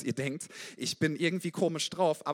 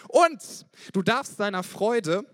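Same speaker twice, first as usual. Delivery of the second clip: slow, much louder, ringing out; loud preaching; 6 semitones higher, 8 dB louder, slower than usual. Real, not acted. Preacher calling the church to show joy, loud and slow. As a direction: preach it with full force. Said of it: "Entschuldigung, wenn ich ein bisschen laut bin".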